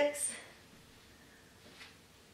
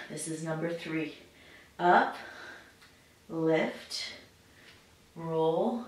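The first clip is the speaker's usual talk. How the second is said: normal talking voice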